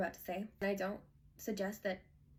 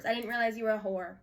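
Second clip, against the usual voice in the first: ordinary delivery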